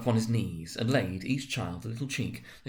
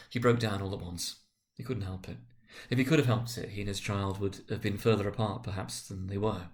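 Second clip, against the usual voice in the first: ordinary delivery, nothing marked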